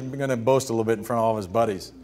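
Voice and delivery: normal talking voice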